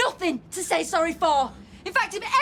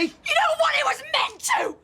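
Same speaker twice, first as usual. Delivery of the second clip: heated shouting; 6 semitones higher, slower than usual. Acted. Couple's quarrel over thrown tea.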